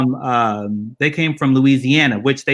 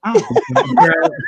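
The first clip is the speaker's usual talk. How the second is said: much higher, laughing through it; 4 dB louder than usual. Real, not acted. Answering the bald cousin joke while laughing hard. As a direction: dodge the question while cracking up.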